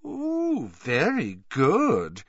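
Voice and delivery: high drawn-out teasing praise; teasing delight. Acted. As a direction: purr it out with glee. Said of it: "her thick glasses twinkling".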